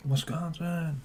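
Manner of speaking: high, soft put-on voice on Wisconsin; odd silly voice. Shown as a demonstration. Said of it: "I don't know why I did that".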